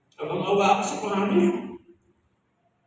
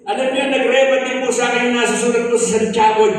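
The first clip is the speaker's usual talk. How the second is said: raised, ringing preacher voice reading scripture; preaching scripture; 4 semitones higher, 6 dB louder than usual. Acted. Ex-convict testifying to the church, reading scripture in preaching voice. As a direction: proclaim the verse to the congregation.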